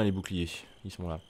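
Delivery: ordinary talk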